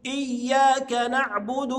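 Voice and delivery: "إياك نعبد" slowly, leaning on the yy; clear doubled ya. Shown as a demonstration. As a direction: hold the shadda in "إياك". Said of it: "لابد أوضح".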